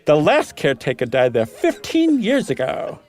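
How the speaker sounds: Meagle voice. Acted. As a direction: lift the voice up high and stretch it like an eccentric host. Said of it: "I love Meagle".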